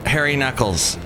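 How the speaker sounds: normal talking voice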